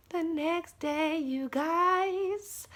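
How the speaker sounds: high, slow, drawn-out repeat; dramatic suspense. Real, not acted. Storyteller teases viewers about what followed, high drawn-out suspense. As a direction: stretch the words high to build suspense.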